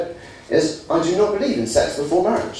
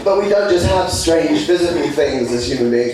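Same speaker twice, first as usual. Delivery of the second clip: ordinary talk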